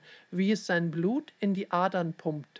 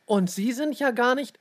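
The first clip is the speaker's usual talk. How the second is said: higher, indignant comeback; indignant retort; 5 semitones higher, 4 dB louder than usual. A narrator's reading. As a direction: start to hit back, pitched up. Leon retorts, gets cut off.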